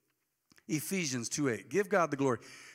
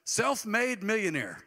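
slow, proud self-made millionaire; mock bragging tone; mock boast; 5 semitones higher, slower than usual. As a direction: drag it out like a braggart. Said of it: "heard folks say that".

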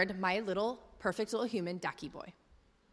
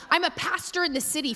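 ordinary talk, nothing marked

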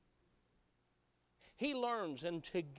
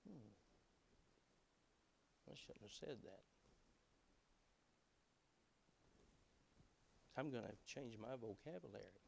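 sheepish murmur; 6 semitones lower, 16 dB quieter than usual. Acted. Convicted man answers the Spirit.

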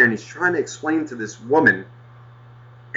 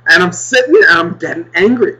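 ordinary talk

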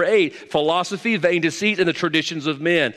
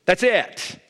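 ordinary delivery

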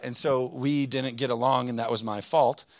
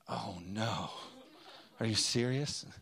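mock sadness; slower than usual. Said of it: "I didn't say that".

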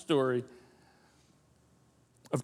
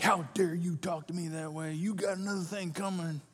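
mock angry father; 4 dB quieter than usual. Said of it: "It wasn't like".

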